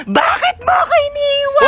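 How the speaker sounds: high wailing shout. Acted. Ghost calling after the family, high wailing shout. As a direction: wail it out at the top of the voice.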